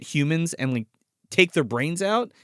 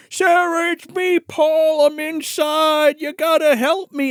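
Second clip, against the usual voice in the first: high, loud, drawn-out creature plea; Paul; 13 semitones higher, 5 dB louder, slower than usual. Retold or imitated. Trapped inside the creature calling Sarah, hammed-up plea. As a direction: stretch each phrase in a high put-on monster voice. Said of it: "and it's, like, talking".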